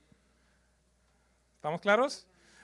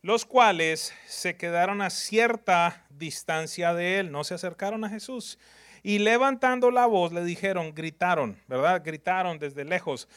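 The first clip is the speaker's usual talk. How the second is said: normal talking voice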